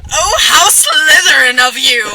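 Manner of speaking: delighted. Acted. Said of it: "That was a compliment".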